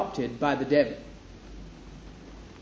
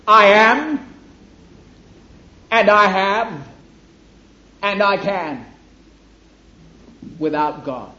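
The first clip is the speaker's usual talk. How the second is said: loud, arrogant "I am", much louder and higher, fading by "I can"; satanic boast; 3 semitones higher, 6 dB louder than usual. Acted. Preacher voicing the devil's attitude to the congregation, arrogant boast. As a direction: swagger out each claim like the devil.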